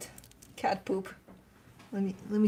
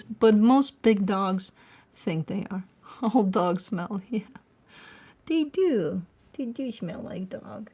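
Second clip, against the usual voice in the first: ordinary talk, nothing marked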